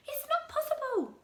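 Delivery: put-on very high, shocked old woman; Mrs Twit. Wife refusing the news, very high and shocked. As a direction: squeal it in disbelief.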